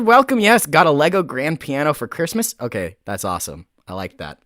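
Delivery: ordinary talk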